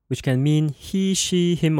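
ordinary talk

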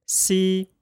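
See held high and level, Mandarin first tone. Shown as a demonstration. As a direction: keep See high and flat. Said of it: "Let's repeat that again".

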